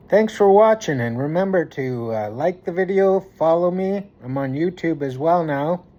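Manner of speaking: usual speaking voice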